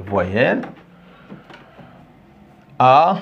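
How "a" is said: A as a long, open "ah"; model vowel. Shown as a demonstration. Teacher has grandson repeat the vowels, model vowel A. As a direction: sound out A on its own for repeating. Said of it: "répète avec moi".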